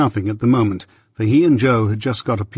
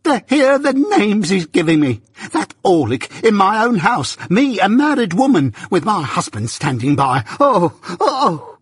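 very high, hysterical outrage; screaming fit; 9 semitones higher than usual. Acted. Married woman insulted in own house, screaming fit. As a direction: shriek each phrase, pitched way up. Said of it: "after a fit of clappings and screamings".

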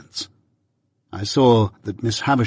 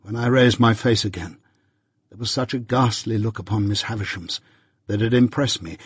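plain reading voice

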